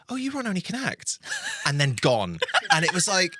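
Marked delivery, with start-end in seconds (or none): 0.1-1.2 very high mock surprise; lightly imitating a delighted staff member; recognising him. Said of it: "one of the guys said".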